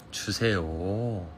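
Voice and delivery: drawn-out "주세요" said very slowly; slow careful Seoul-style "주세요"; slow polite request. Shown as a demonstration. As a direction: stretch every syllable of "주세요" politely. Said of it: "똑똑하다".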